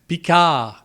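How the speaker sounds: Picard, final D dropped; French pronunciation. Shown as a demonstration. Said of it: "You pronounce it in French".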